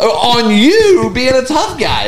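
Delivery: high-pitched, incredulous heckling. Retold or imitated. Viewer taunting the chef who got challenged, incredulous. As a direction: egg him on in disbelief, pitch up. Acted reaction to chef's confrontation.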